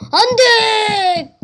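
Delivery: high, slow drawn-out wail; ghost's dismayed cry. Pretend. Ghost hearing mom coming, high drawn-out wail. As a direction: wail as the ghost gets caught out. Ghost fleeing mom's footsteps.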